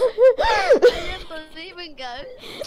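loud, slow laughing wail; cry-laughing through the words; laughing sob. Real, not acted. Gamer laughing so hard it sounds like crying. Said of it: "I sound like I'm crying, but I'm laughing".

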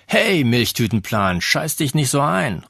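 teasing shout; calling across. A narrator's reading. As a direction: taunt her across the room. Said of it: "rief er rüber".

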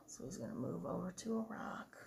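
quick hushed aside; whisper. Real, not acted. Parent quietly explaining the story to child.

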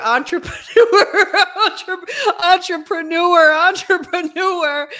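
laughing harder with each repeat, high and loud; high, slowed, laughing mockery of the word. Real, not acted. Host repeating the businesses' buzzword to co-host, laughing. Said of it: "you and I have laughed about this".

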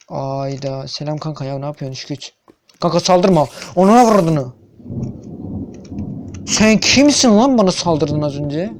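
usual speaking voice

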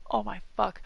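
ordinary talk

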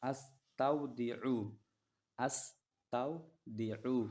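stretched out syllable by syllable; slow Arabic recitation. Shown as a demonstration. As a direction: draw the word out for learners. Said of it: "সফরকারীকে বিদায় দেওয়ার দোয়া।".

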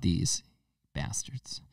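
soft under-the-breath insult; muttered curse. Acted. Swearing at poll voters under the breath. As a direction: hiss it under your breath.

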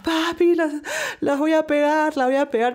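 high, loud thrilled announcement; excited to parents. Retold or imitated. Tells parents she'll make it. (emocionada, aguda y fuerte)

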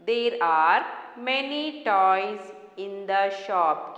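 slow, word by word reading; slow dictation. Shown as a demonstration. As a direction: read it slowly for children to follow. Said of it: "Keep your fingers".

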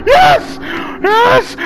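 very high, loud squeal of relief; triumphant scream. Real, not acted. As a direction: scream it out, you made it. Escapes the chase.

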